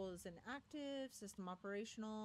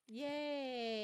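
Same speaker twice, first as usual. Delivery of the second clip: mock-cheerful, louder yay; sarcastic cheer; 4 semitones higher, 6 dB louder than usual. Real, not acted. Player mock-cheering the propaganda, ironic yay.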